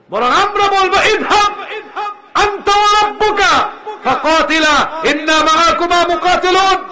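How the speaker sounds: shouted high and loud, ringing with zeal; fiery battle pledge. Acted. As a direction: roar the vow like a warrior ready for battle. Miqdad's fiery pledge to Prophet.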